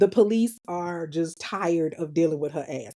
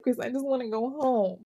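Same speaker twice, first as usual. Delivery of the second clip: higher, plaintive little whine; mock whining; 5 semitones higher than usual. Sister begging to go home, whining. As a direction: imitate a sister whining to her brother. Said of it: "she starts whining".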